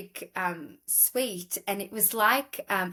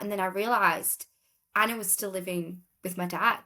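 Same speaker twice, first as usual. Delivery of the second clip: ordinary talk, nothing marked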